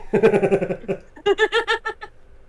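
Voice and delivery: loud, high burst of laughter; laughing at his own joke. Real, not acted. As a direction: crack up.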